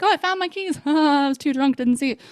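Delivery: high, sing-song mock voice. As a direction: mimic his breezy carelessness. Mocking boyfriend's casual tone.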